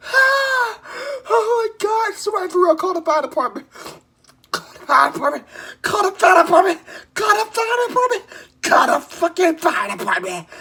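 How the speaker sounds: high, loud frantic screaming; panicked screaming. Real, not acted. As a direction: scream for help in real panic. Screaming for the fire department.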